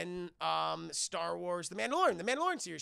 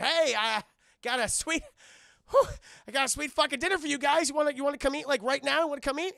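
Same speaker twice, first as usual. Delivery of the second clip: louder, higher, fast salesman pitch; eager host; 8 semitones higher, 9 dB louder, faster than usual. Acted. Lando pushing heroes into dinner, eager and loud. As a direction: oversell the dinner invite.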